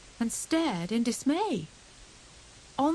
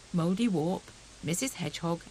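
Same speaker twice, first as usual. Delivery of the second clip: ordinary delivery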